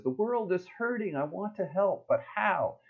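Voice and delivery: high little cartoon chipmunk voice; worried chipmunk. A chipmunk fretting to its friend about the world, high and small. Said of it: "the first one says".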